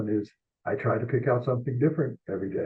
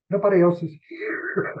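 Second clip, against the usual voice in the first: amazed realization; 8 semitones higher, 4 dB louder than usual. Retold or imitated. Alone at historic upset.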